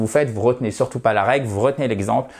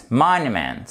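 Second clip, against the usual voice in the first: "monument" stressed on MON, pitch falling after it; stress on first syllable. Shown as a demonstration. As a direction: punch the opening syllable of "monument". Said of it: "l'accent tonique".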